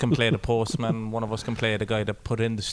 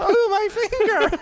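high, laughing cry of pain; hammed-up granny wail; 3 dB louder, slower than usual. Podcast host hams up the granny's film line, laughing. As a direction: squeal it like a hurt granny in a film. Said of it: "that dialogue's a bit weak now for me".

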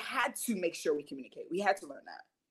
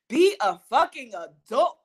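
louder and slow, leaning hard on "fucking"; emphatic; 4 semitones higher, 8 dB louder than usual. Real, not acted. Telling listeners to grow up, loud and emphatic. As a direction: land each word of "Be a fucking adult" like a verdict. Correcting herself with stronger word.

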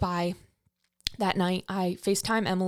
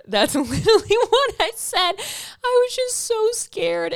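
very high voice through laughter; laughing squeal; 13 semitones higher, 4 dB louder than usual. Retold or imitated. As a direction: laugh while keeping the mock-crying pitch. Laughs at her own crying re-enactment.

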